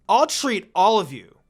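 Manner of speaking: loud, sudden offer; loud yelled announcement. A narrator's reading. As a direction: burst out with it. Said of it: "Sakura suddenly yelled".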